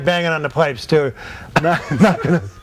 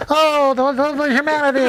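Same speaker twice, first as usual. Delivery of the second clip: high, strained mock newsreel announcer; mock radio announcer lament; Hindenburg announcer; 9 semitones higher than usual. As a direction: wail it like the old Hindenburg broadcast. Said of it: "that announcement went".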